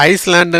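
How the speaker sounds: ஐஸ்லாண்ட் as "ice-land"; ஐஸ்லாண்ட் with a hissed s before "land"; wrong pronunciation. Shown as a demonstration. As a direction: sound the S in ஐஸ்லாண்ட். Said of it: "இல்ல".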